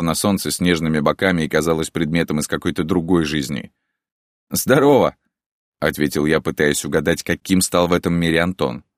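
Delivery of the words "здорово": higher and louder hello; greeting call. A narrator's reading. Hero greets friend back. (громкое приветствие)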